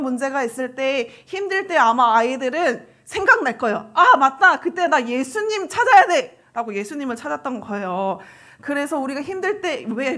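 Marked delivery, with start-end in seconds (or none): 3.9-6.4 higher, louder voicing of a realization; imitated sudden realization. Child remembering in hard times, mock sudden realization. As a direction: exclaim it, then stretch the words.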